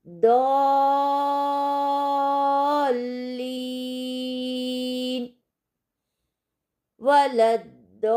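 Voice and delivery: दौलीन held very long, thick and full; six-count stretch. Shown as a demonstration. Teacher recites वलद्दौलीन with the long madd. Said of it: "और हम इसको अदा करें".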